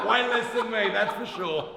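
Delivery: laughing loudly through the words; loud amused answer, still laughing. Real, not acted. Joking about toddler bench press.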